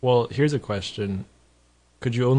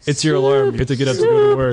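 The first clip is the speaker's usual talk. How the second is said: loud urgent narration; 3 semitones higher, 7 dB louder, faster than usual. Pretend. Alarm takes over, loud.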